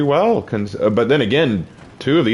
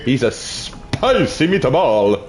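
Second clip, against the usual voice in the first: slow, higher cartoon Italian accent; mock Italian accent; 4 semitones higher, much slower than usual. Teasing a half-Italian viewer, hammed-up Italian accent. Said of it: "I will sound like Mario".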